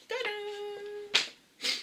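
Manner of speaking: high, bright tadam for the reveal; playful reveal. Real, not acted. Unveiling her Sephora haul to viewers, high playful tadam. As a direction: sing out the reveal with a flourish.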